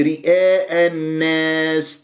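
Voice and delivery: رئاء with the alif tilted, lips spread in a smile; wrong tilted alif. Shown as a demonstration. Teacher mimicking a student's wrong alif in رئاء. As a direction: say رئاء with a smiling, leaning alif. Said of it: "شوف ما تقول لي".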